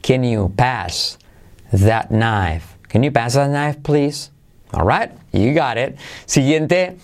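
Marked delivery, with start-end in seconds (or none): 0.0-2.8 slow "Can you pass that knife", broken into two chunks; drawn-out learner-paced phrase; repeat-after-me model. Shown as a demonstration. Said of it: "¿Conmigo?". 2.9-4.3 "Can you pass that knife, please" in one higher, flowing run; natural-speed full sentence; joined-up model. Shown as a demonstration. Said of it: "¿Conmigo?".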